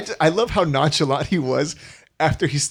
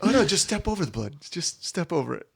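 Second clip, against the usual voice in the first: mock nonchalant; 8 dB quieter than usual. Mocking the killer's nonchalance.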